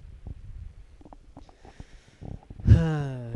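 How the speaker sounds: long, loud breathy sigh; weary sigh. Real, not acted. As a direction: let out a heavy, unmotivated sigh. Weary opener about lacking motivation.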